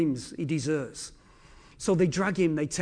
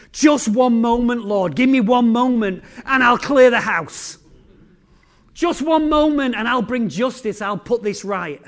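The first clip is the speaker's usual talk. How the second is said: loud, urgent plea to be let loose; an angel straining to act, loud; archangel's loud plea; 3 semitones higher, 6 dB louder than usual. Acted. Archangel begs the Lord to let him avenge, loud plea. Archangel pleading to strike back.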